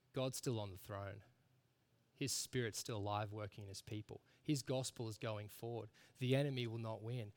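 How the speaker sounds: low, soft, steady declarations; quiet assurance. Real, not acted. Preacher reassures weary congregation of what they know, quietly certain.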